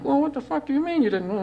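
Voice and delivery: mock angry parent. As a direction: imitate a parent starting a fight. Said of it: "an argument starting".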